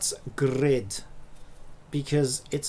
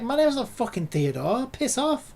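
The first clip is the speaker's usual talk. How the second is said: indignant snap; 7 semitones higher than usual. Retold or imitated. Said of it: "It's like".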